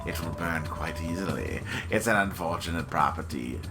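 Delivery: low, suave merchant voice; creepy salesman pitch; sinister shopkeeper. Acted. Reads shopkeeper dialogue.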